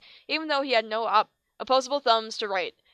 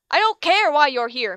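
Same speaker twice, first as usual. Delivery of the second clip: angry teacher; 4 semitones higher, 8 dB louder than usual. Acted.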